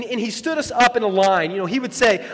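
ordinary talk

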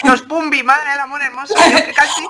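thrilled nostalgic exclamation with a laugh; excited. Real, not acted. Host recalls the Pumbi comics excitedly, excited.

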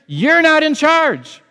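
high, loud defiant declaration; missionary defying his cancer, loud and high; defiant shout at cancer. Retold or imitated. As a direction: shout it down like you refuse to die. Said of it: "what he said to that cancer".

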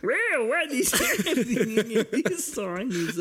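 squeaky high giggle through the words; can't get the sentence out for laughing. Real, not acted. Co-host cracks up on the podcast, laughing high. Giggling after the bad accent joke.

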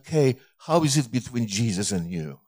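low, hushed retold question; gentle personal question. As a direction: ask it softly, almost confidingly. Gently asks sick woman personal question.

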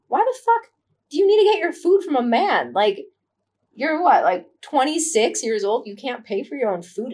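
high, loud, disbelieving rant; incredulous outburst. Retold or imitated. Scolding Sarah for needing a man to buy her food, outraged. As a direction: tear into her like she's being ridiculous.

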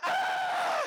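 high piercing mock scream; horror scream. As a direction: scream like a horror movie victim. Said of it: "and I also screamed".

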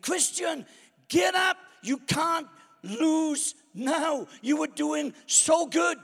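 high, loud pleading yell; desperate crowd shout. Acted. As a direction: beg her to get up. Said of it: "The crowd are on their feet".

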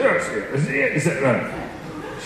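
quick mock Glaswegian repeat; Glaswegian accent. Glasgow student repeating himself to Hannah, in a light Glaswegian accent. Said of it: "He happens to be from Glasgow".

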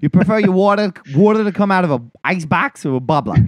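hammed-up Boston with dropped r's; terrible Boston accent. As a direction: ask like a mock Bostonian. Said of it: "in a terrible Boston accent".

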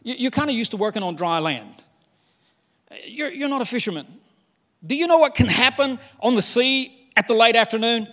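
a hint of skeptical fishermen warning their teacher; fishermen doubting Jesus. Fishermen warning the carpenter about the sea, mildly incredulous. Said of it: "I can imagine them thinking".

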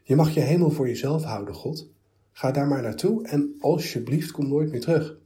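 loud, high call full of disgust. A narrator's reading. The philosophers drive God away in loud disgust. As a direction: throw him out with contempt. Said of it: "riepen ze vol afkeer".

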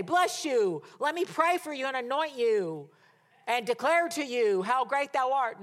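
high, loud, sweeping pronouncement; mock grand blessing. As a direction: proclaim a hammed-up blessing over them. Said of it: "he didn't say".

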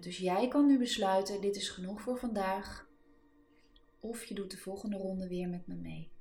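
calm, steady breathwork coaching; guided breathing. Real, not acted.

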